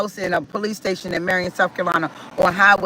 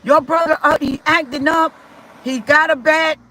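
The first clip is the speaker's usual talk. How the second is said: urgent plea; 6 semitones higher than usual. Retold or imitated. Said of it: "I said".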